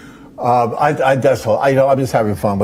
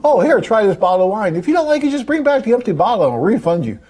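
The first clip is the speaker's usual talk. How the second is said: a hint of an eager shop clerk, higher-pitched; store pitch, imitated; 9 semitones higher than usual. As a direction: lightly act out a generous store offer. Voices the store's generous policy.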